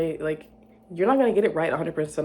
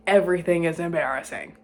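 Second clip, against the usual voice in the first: usual speaking voice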